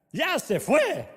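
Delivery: high then loud, slow, mock-delighted; relieved widow. Widow gushes to the pastor about her dead husband, loud and slow. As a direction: gush like a widow glad he's gone. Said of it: "Y venía la viuda".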